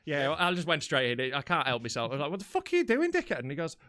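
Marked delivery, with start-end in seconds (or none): 2.3-3.4 high, loud, indignant challenge; retold angry outburst. Driver confronts man at the pump, acted outrage. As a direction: fire it off in acted disbelief. Acting out row at petrol station.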